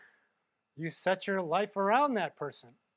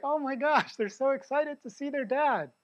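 higher, louder gush of delight; excited; 9 semitones higher, 4 dB louder than usual. Retold or imitated. Uncle watching nieces and nephews greet dad, excited. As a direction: gush over the kids' joy. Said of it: "And it's like".

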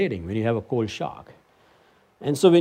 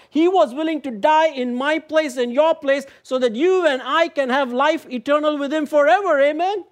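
preaching at full force; 12 semitones higher, 3 dB louder than usual. Acted. Preacher to congregation, proclaiming Christ with rising fervour.

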